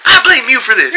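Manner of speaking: high, put-on voice of an accusing coworker; mock accusing coworker. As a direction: sing it out like an accusation.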